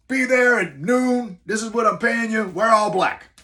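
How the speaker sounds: high, loud Bronx orders, slow at first; heavy Bronx accent. Retold or imitated. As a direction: bark the orders like a Bronx boss. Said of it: "Very heavy New York Bronx accent".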